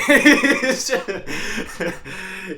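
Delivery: high loud laugh; loud laughing fit; burst of laughter. Real, not acted. Laughing at the story.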